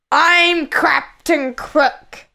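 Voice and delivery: slow, fierce pirate captain; fierce, drawn-out pirate growl; Captain Crook growl. A narrator's reading. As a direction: growl it out slowly, menacingly. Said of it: "growled the fierce man".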